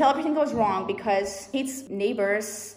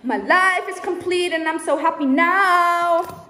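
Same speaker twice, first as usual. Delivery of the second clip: higher, louder, slow happy gush; blissful delight; 7 semitones higher, 5 dB louder, slower than usual. Real, not acted. Telling viewers she is happy, high and gushing. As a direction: gush with joy.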